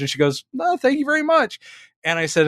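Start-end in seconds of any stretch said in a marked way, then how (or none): 0.5-1.6 much higher, hammed-up gracious thanks; hammed-up gracious actress. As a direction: go way up for her reply. Retold quote of the actress again.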